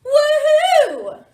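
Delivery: high, very loud whoop; crew's cheer. A narrator's reading. Pirate crew off to find treasure, loud excited cheer. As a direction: let out a long, jubilant whoop. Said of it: "cries the crew".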